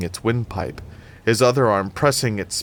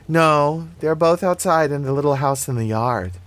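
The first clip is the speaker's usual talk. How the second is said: lightly voiced little girl, pitched up; child correcting adult; 6 semitones higher, 3 dB louder than usual. A narrator's reading. Child corrects Annie about the dryer, higher kid voice. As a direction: explain it as a kid would, a bit higher.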